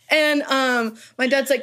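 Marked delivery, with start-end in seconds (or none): none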